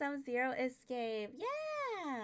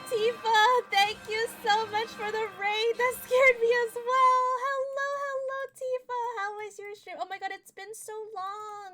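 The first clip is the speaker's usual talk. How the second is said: excited high greeting; 11 semitones higher, 10 dB louder than usual. Real, not acted.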